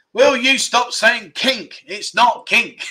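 high, loud, drawn-out telling-off; mock exasperation. Real, not acted. Host telling quiz viewers to stop guessing, mock exasperated. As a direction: scold them playfully, stretching every word.

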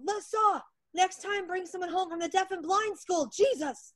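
put-on a grumpy old grandfather's high, loud yell. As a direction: yell it across the room like a cranky grandpa. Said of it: "her grandfather yelled".

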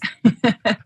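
bright burst of laughter at her own joke; real laugh. Real, not acted. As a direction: laugh freely, amused at yourself.

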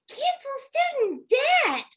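high, slow singsong mimicry; mocking slogan. Host mocking a senator's constant rhetoric, high singsong. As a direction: chant it high and drawn out, mockingly.

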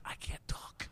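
voiceless rasp, voice shot; airy whisper of a blown voice. Retold or imitated. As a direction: strain to get words out with no voice left. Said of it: "Completely blow my voice out".

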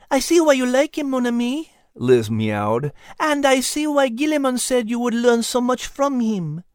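high, purring cat voice with a French touch; a wise cat speaking fondly of her mon ami; high feline voice, knowing and affectionate; Liz the cat. Acted. Narrator voicing Liz the cat.

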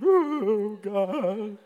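high, loud comic wail; mock ugly crying. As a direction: imitate a blubbering ugly cry, hammed up. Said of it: "ugly cry weep".

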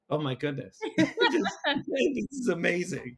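imitating a thrilled fan, high pitched; retold gushing praise, pitch lifting high; amazed. Favorite blogger commenting on his post, amazed.

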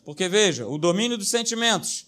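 normal talking voice

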